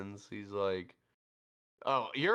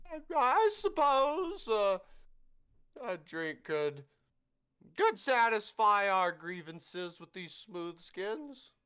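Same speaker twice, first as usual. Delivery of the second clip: high, drawn-out, grudging voice; slow high haughty voice; 10 semitones higher, 5 dB louder, slower than usual. Acted. Angry villager to the party, slow high grudging reply. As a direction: stretch each word out, lofty and reluctant.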